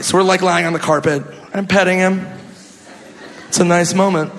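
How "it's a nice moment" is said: normal talking voice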